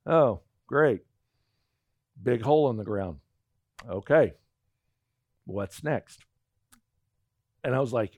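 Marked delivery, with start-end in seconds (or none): 0.0-6.2 low, tired and unimpressed, mildly imitated; weary deadpan. Imitating the exhausted wife at the canyon, low and unimpressed. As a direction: sigh it out like someone with nothing left. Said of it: "she spent".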